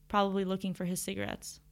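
plain reading voice

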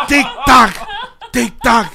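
high loud drawn-out tic-tac; Franklin Virgo impression. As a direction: chant it like a showman. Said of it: "Franklin Virgo sí lo". (tic-tac de locutor exagerado)

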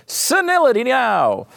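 high, drawn-out mock yell; yelled catchphrase. Host plugging a catchphrase T-shirt, yelled high. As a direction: holler it as a slogan.

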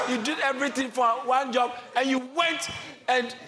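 high, loud preacher in full flow; rousing sermon delivery with shouted peaks; fired-up preaching. Real, not acted. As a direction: preach it hard, pitched high.

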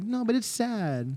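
high, soft, slow plaintive protest; mock-pitying protest. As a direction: protest softly up high, drawing out the pity. Insisting the dog is sad.

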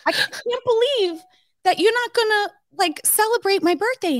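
put-on high, whining kid voice; whiny teenage son. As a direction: complain like a sulky son. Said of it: "he's like".

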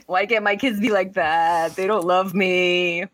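slow, whiny mock lament; a touch of pretend heartbreak; mock self-pity. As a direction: wail it like a hurt, unloved mother, hammed-up. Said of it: "and I was like".

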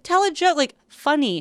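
high, playful coaxing; olive-branch banter. Retold or imitated. Teller replays her olive branch to the girl, high playful coaxing. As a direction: offer it as a friendly nudge. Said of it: "like, olive branch".

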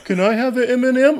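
put-on high, hesitant child voice; begging kids. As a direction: ask timidly, like a kid after candy. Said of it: "They go".

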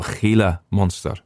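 Gila monster in a put-on Spanish accent; throaty Spanish ch on Gila, Spanish accent through monster. Shown as a demonstration. Apologising later for overdoing it, Spanish accent on Gila monster. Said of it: "monster bit I didn't have to do in a Spanish accent".

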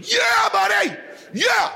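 high, exuberant shout; celebratory yell. Retold or imitated. Dad cheering son's first birdie, celebratory yell. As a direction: whoop it for your boy.

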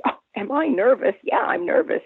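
high, emphatic admission of nerves; acted nervousness. Young singer answering [name] before the show, nervous. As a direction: play up the nerves, pitched high. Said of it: "Are you nervous?".

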